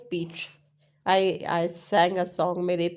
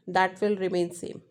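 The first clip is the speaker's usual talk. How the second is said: ordinary talk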